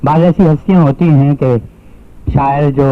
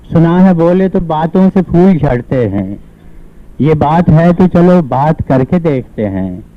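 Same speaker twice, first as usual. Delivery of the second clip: higher, lilting poetic recitation with measured pauses; ghazal recitation; 4 semitones higher, slower than usual. Acted. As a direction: recite each couplet to a mushaira audience, lifted and cadenced. Recites his ghazal at mushaira. (मुशायरे के अंदाज़ में तरन्नुम-रहित ग़ज़ल पाठ)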